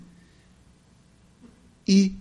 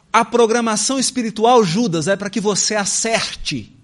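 louder, urgent plea; pleading appeal; 5 semitones higher, 7 dB louder than usual. Acted. Jesus pleading with Judas, louder and urgent. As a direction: beg him to turn back now.

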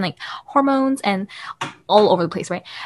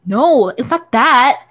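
drawn-out, louder annoyed objection; annoyed interruption; 4 semitones higher, 6 dB louder, much slower than usual. Retold or imitated. Brother stopping mom midway to correct her, annoyed. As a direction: cut in slowly and insistently, imitating irritation. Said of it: "interrupts her, and he's like".

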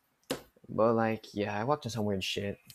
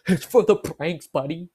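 loud, high, slow taunting yell; loud mock taunt to the coworker; gloating shout; 7 semitones higher, 11 dB louder, slower than usual. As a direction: crow it like a proud prankster.

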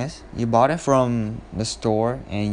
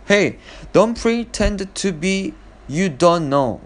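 raised, pitched-up accusing tone, high on Hey don't; accusing call-out; 8 semitones higher than usual. Shown as a demonstration. Calling out a brother who ate the ice cream, accusing. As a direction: catch him out, pitched well up. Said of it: "the expression that I want to say is".

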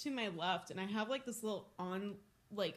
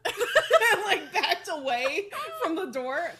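laughing; 8 semitones higher, 10 dB louder than usual. Real, not acted. Laughing while retelling the fright.

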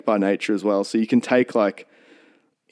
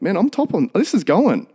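high-pitched, thrilled self-talk; mock giddy confidence; acted elation; 9 semitones higher than usual. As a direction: play someone amazed it is working. Thrilled walker in retold surrender moment.

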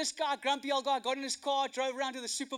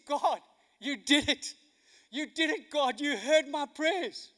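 raised, emphatic cry of thanks; widow's grateful praise; 4 semitones higher, 5 dB louder, slower than usual. Retold or imitated. Widow thanking God for the groceries, exultant praise. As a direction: lift it up as praise, stretching the last words. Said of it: "She put her arms in the air and she said".

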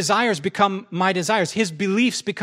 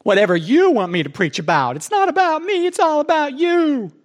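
pious pleading; 10 semitones higher, 4 dB louder than usual. Retold or imitated. Pastor mocking his own pious answer to God, high and loud. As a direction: sing it out like an overly devout prayer.

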